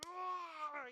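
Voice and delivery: put-on high, loud kid's battle cry; mock fighting yell. Preacher acting two kids wrestling, a loud high yell. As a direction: yell like a scrapping kid.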